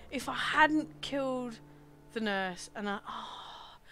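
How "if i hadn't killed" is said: ordinary talk, nothing marked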